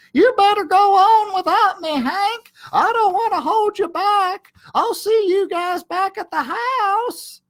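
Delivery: very high, loud whining little dog voice; martyred Drover. Acted. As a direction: play the noble sacrifice a bit too hard.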